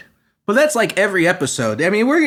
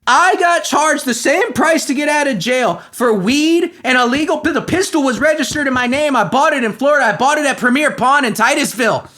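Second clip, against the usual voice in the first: furious rant; 6 semitones higher than usual. Real, not acted. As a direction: vent real outrage at the unfair bond. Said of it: "I actually am legitimately pissed".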